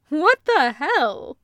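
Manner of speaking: playful indignation; slowed, teasing exclamation; amused disbelief. A narrator's reading. Loop mock outraged.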